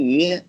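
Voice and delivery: YU low and dipping, then turning up; third tone. Shown as a demonstration. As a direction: drop YU down and bring it back up. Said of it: "The third one?".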